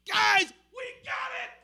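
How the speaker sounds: high, loud, slow triumphant cry; triumphant shout. Acted. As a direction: shout it like you've just received the power. Disciples celebrating the power.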